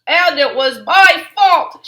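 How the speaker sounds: put-on loud, slow boy-cub confession; shouting. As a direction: shout out the blame. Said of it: "shouted Brother".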